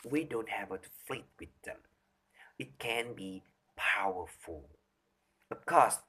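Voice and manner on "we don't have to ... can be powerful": quiet. Shown as a demonstration. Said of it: "our old friends silence".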